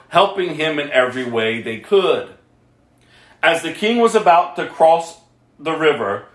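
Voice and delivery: usual speaking voice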